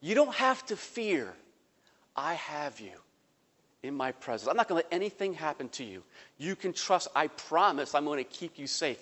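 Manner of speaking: soft, gentle fatherly reassurance; calm a scared son softly; reassuring father. Retold or imitated. Dad on the raft gently reassuring his frightened son, soft and tender. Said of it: "my dad says".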